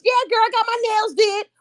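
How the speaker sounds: put-on high-pitched chatty girl talk; gossiping call-center worker. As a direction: gossip with a coworker between calls.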